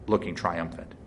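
plain reading voice

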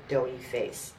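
usual speaking voice

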